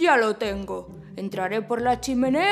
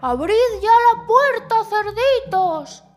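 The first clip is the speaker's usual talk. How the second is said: angry wolf; 5 semitones higher, 7 dB louder, slower than usual. Acted.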